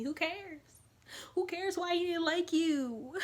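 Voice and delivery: high, drawn-out sing-song brush-off; exasperated dismissal. Retold or imitated. Answering a friend hung up on a guy, exasperated.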